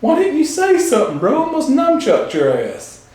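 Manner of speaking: high mock voice, slowing at the end; Will Ferrell's voice. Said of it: "He goes".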